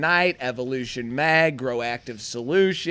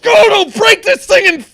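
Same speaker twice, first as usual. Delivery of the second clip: much higher, much louder screaming promo; wrestling promo yell; 13 dB louder than usual. Acted. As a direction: scream it like a wrestler hyping a match. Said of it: "have Steve all worked up".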